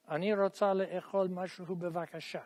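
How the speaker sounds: rattled off fast like the tape; imitating the fast speaker on the tape; really fast. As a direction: rush the whole sentence in one breath. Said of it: "She says it really fast".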